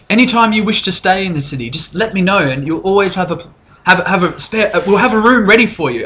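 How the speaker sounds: high, polite innkeeper voice; barmaid Licit. Acted. Innkeeper introducing herself to guests, high and polite. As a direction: fluster politely, voice lifted. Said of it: "She goes".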